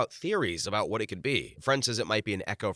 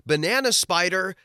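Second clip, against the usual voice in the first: sharp high yell of warning; startled yell of alarm; 9 semitones higher, 6 dB louder than usual. Retold or imitated. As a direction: shout the warning out loud. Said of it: "stopped short and yelled".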